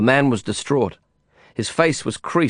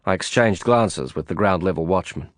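plain reading voice

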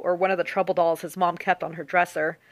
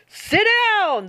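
high, drawn-out bellowed order; 4 dB louder than usual. A narrator's reading. Driver from the front to the standing girl, bellowing. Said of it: "the driver bellowed from the front".

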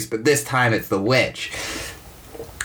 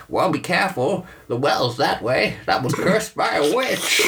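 put-on old person; 5 semitones higher than usual. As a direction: warn them like a spooky old hermit. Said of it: "this old person comes out like".

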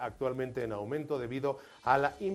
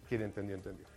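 normal talking voice

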